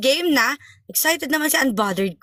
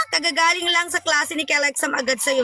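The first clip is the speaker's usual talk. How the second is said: usual speaking voice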